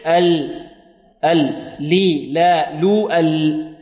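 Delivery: ال، لي، لا، لو with a clearly ringing ل; correct voiced lam. Shown as a demonstration. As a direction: voice every ل fully, holding the one in ال. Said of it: "الصحيح".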